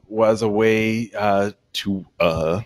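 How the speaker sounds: normal talking voice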